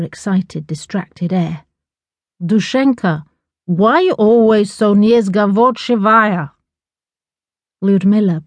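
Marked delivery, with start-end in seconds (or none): 2.4-6.5 slow scolding foreign mother. A narrator's reading. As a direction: scold slowly with a Russian word. Mother scolds daughter with Russian word.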